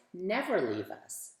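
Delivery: ordinary delivery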